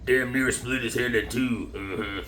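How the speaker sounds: low, soft, slow-drawled country grunt; Sling Blade voice. Retold or imitated. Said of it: "Like Sling Blade".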